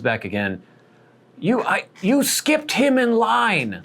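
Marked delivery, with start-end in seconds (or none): 1.4-3.8 put-on high, slow old man voice pointing blame; tattling old man. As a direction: pitch way up and tell on someone slowly. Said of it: "saying to the woman again".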